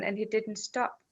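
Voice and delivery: ordinary talk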